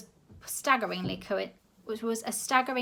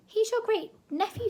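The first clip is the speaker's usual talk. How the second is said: much higher exclamation; exclaiming; 14 semitones higher, 4 dB louder than usual. A narrator's reading. Grandma exposing Sid to the director, high exclaim. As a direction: blurt it out high. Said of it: "exclaimed Grandma".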